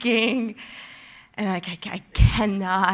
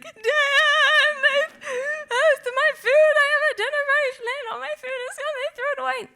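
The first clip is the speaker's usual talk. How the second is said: much higher, wailing through tears; crying to a friend; 6 dB louder than usual. Retold or imitated. Calling her friend Dan, wailing through tears. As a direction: sob it out to your friend. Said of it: "And I was like".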